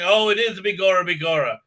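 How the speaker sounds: quick, hammed-up stage Irish accent; generic Irish accent. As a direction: rattle off a cod Irish accent. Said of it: "very generic insulting Irish accent".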